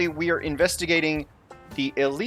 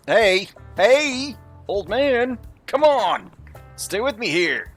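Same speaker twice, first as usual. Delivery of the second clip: high pleading voice; 10 semitones higher, 5 dB louder, slower than usual. Acted. Urging collapsed old man.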